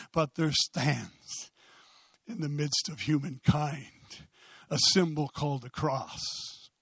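low, soft, measured reverence; hushed solemn preaching. Real, not acted. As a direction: bring it right down and let each phrase land.

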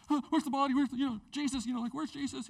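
mock-panicked searching; frantic confusion. Mary at the empty tomb, frantically confused. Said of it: "I'm sure she was".

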